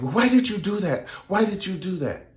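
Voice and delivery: high, upset questioning; mock outraged husband; shocked husband. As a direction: demand why like a stunned groom. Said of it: "so then he was like".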